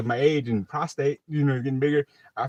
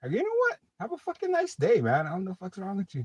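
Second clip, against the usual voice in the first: high, dismissive father voice; mocking dad voice; 9 semitones higher than usual. Father brushing off his son, high dismissive mock. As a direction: toss it off as the fed-up old man. Dad's reply voiced in story.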